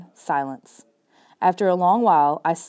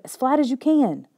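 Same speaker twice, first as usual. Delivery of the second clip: urgent order; 7 semitones higher than usual. A narrator's reading. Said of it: "he ordered".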